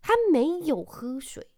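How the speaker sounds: put-on high, loud, slow child insisting; insistent child. Son insisting to mom the kid wasn't drinking, in a loud, high child voice. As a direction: hammer home the child's excuse. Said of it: "那边跟我大声说".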